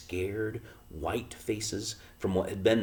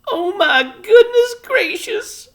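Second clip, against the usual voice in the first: very high, loud slow wail; a little mother overwhelmed, half crying; moaning; 14 dB louder, slower than usual. A narrator's reading. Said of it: "moaned Homily".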